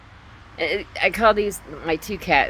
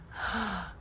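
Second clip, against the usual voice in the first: high, soft gasp; sharp intake of breath; 7 semitones higher, 8 dB quieter than usual. Real, not acted. Sees the camera going out of focus, gasping.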